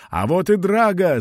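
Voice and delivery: high, loud excited shout. Acted. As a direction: call it out to everyone. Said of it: "закричал Вико".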